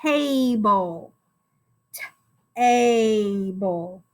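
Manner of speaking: "Table" drawn out, t then a long ay then bul; sounding out. Shown as a demonstration. Teacher lets pupils try alone, sounding out. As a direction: slowly stretch the sounds of "Table". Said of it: "try it on your own".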